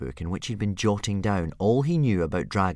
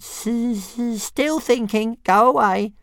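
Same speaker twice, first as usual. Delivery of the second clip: much higher, stuttering dragon brush-off; little dragon shooing; 8 dB louder, slower than usual. Acted. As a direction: wave him off like a busy pet. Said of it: "Toothless voice".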